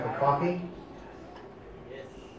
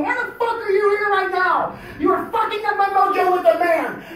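high, loud rant at a bee; furious telling-off; 8 dB louder than usual. Retold or imitated. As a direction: yell at the intruder, outraged. Telling off a bee during prayer.

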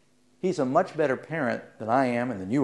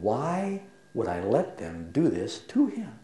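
normal talking voice

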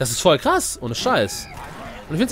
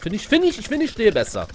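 high emphatic; 9 semitones higher than usual. Real, not acted. High emphatic self-assurance.

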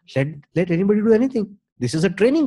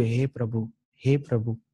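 soft repeated call; 6 semitones lower, 7 dB quieter than usual. Shown as a demonstration. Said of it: "હું બે વખત કહું છું".

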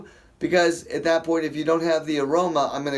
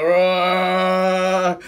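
louder mock roar of a movie tree; tree monster roar; 3 semitones higher, 5 dB louder than usual. Preacher imitating the talking trees from the movies, mock roar. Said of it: "the trees are like".

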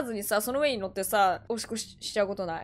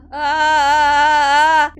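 drawn-out wail; 3 semitones higher, 9 dB louder, much slower than usual. Real, not acted. Drawn-out horrified groan.

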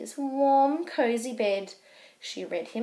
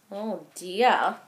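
ordinary delivery, nothing marked

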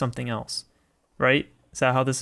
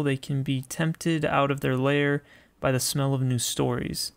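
ordinary talk, nothing marked

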